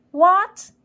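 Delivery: a sharp, high, loud shout of disbelief; shouted what. A narrator's reading. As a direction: bark it up high like an outraged queen. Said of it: "shouted Quintot".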